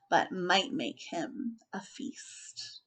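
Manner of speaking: hushed, slow warning; slow spooky whisper. A narrator's reading. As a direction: drop to an ominous whisper. Ominous warning in narration.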